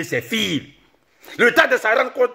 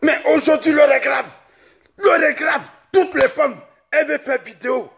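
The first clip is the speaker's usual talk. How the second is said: a comic tirade shouted high; ranting shout; 7 semitones higher than usual. Acted. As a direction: scold them all, pitched up and yelling. Declares the hour grave.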